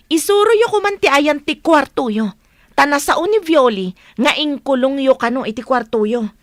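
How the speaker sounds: loud, urgent demand; urgent shout. Acted. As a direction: shout at him to hurry. Demanding answers from the boss.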